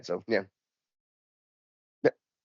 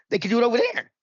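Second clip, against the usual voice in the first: retold argument, pitched way up; exasperated protest; 12 semitones higher, 4 dB louder than usual. Traveler arguing with the check-in agent, exasperated.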